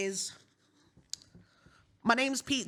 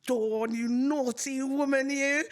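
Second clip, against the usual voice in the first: mock scolding; slower than usual. Teasing a politician playfully.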